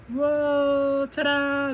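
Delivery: high, loud, sustained flourish; triumphant hero. Acted. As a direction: show off the rescue with a ringing cry. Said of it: "That's what he says".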